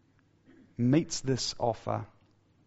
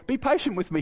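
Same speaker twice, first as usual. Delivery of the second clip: high-pitched, exaggerated servant's plea; mock begging; 12 semitones higher than usual. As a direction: beg in a much higher, hammed-up voice. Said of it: "he begged".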